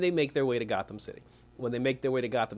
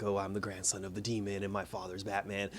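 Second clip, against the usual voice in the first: low, soft, brooding mock menace; Damian's put-on voice; 5 semitones lower, 9 dB quieter than usual. Reviewer mocking Damian's edgy voice, low and soft. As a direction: imitate the kid's edgy voice. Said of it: "I don't know why he did the voice".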